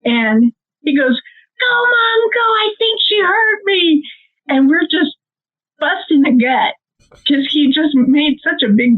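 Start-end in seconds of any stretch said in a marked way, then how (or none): 1.6-4.0 high-pitched, drawn-out frantic plea; mock panic, pitched way up, urging a getaway; urgent panic. Son to mom in the getaway car, urgent high panic.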